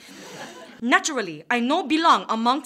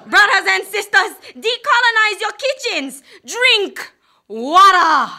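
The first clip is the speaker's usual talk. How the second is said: rally preaching; 5 semitones higher, 7 dB louder than usual. Acted. Preaching to audience.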